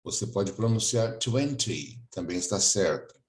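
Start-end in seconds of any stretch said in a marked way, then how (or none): none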